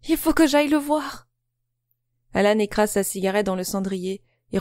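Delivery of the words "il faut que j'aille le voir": urgent. A narrator's reading. Still overwhelmed daughter.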